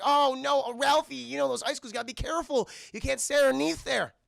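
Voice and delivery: high, loud mock-motherly voice; fussing mom. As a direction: fuss over a hurt child. Mom fusses over hurt son.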